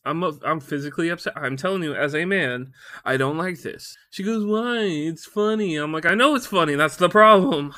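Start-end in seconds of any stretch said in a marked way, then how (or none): 4.5-5.7 high, playful protest; imitated wife. Wife defending her pun to husband, high imitation. 6.1-7.7 loud, raised mock exasperation; exasperated outburst. Husband exasperated by wife's funny pun, loud outburst.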